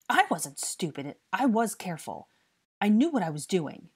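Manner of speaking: loud, emphatic self-defence; defiant declaration. A narrator's reading. Boy defends himself against being called careless, defiant. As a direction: insist on it, stung. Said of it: "he declared".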